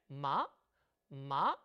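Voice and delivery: "마" short, starting low and swooping up; second tone demo. Shown as a demonstration. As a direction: flick "마" upward quickly from low. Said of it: "이 성은 짧게 감아쳐 올라갑니다".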